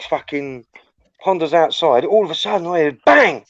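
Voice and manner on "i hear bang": normal talking voice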